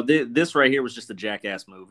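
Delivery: usual speaking voice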